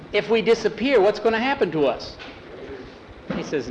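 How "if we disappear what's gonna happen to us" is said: higher-pitched, mildly anxious question; worried Jackie. A narrator's reading. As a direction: voice a nervous companion, pitched up. Jackie is frightened, asks Tom.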